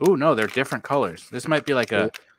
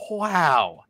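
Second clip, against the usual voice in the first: usual speaking voice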